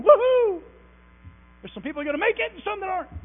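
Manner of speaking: shrill, fast whoop of mock glee; mock celebrating. Churchgoers leaving church, mock whooping for joy. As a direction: ham up a gleeful cheer. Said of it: "running out of church going".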